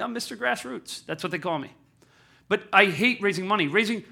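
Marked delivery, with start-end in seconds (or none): 0.0-1.1 softer, higher, playfully cool self-introduction; mock cool. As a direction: introduce yourself with tongue-in-cheek cool. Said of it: "like a cool way of saying".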